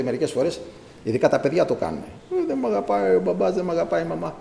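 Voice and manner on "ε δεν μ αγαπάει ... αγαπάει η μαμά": high-pitched imitation of a whiny child; mock childish whining. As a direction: whine like a child feeling unloved. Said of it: "Αν με πιάσει η κλάψα".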